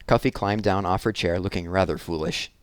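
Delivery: usual speaking voice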